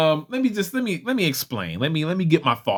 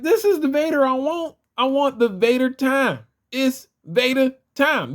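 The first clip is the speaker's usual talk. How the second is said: high-pitched, eager self-quote; insistent, high excited declaration; excited fanboy; 9 semitones higher than usual. Retold or imitated. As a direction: re-enact being thrilled at finding the one. Quoting own eager reaction to figure.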